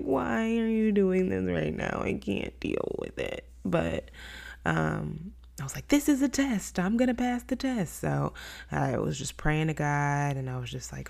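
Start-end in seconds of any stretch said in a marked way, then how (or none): 0.0-3.5 exasperated whine. Retold or imitated. Retelling exasperation at her toddler's mess. 5.9-8.0 self pep talk. Retold or imitated. Retelling her pep talk to herself.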